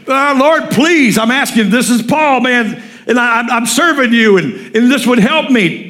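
high, insistent acted begging; aggressive pleading. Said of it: "I'm sure aggressive".

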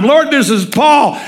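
high, drawn-out acted plea; aggressive pleading. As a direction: appeal to God with pitch up, stretching the words. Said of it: "I'm sure aggressive".

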